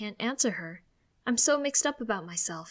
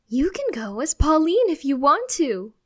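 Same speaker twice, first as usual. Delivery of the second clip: higher and louder, triumphant; cheering; 7 semitones higher, 10 dB louder than usual. Acted. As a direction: cheer it out proudly. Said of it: "she cheers".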